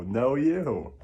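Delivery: normal talking voice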